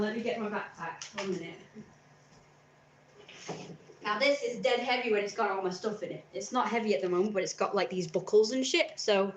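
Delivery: ordinary talk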